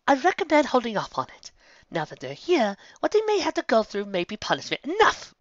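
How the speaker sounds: high refined lady voice, calm; Rarity's voice. Acted. Rarity advising Applejack against punishment, high and composed. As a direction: speak as an elegant mare advising restraint.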